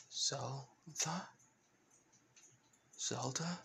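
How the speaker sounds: very soft, halting question; hushed questioning. Acted. Licht hearing his daughter's name, hushed questioning. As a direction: say the name softly, rising in doubt.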